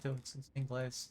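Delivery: ordinary delivery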